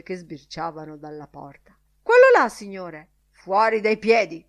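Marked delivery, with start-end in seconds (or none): 2.0-3.1 loud, sudden outburst; accusing newsboy. A narrator's reading. Newsboy pointing at faces in the door, accusing. 3.3-4.4 loud, gruff dismissal. A narrator's reading. Professor throwing the boy out, gruff. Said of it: "disse burbero il professor McHugh".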